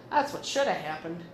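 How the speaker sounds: normal talking voice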